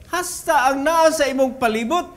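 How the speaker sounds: usual speaking voice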